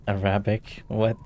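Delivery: normal talking voice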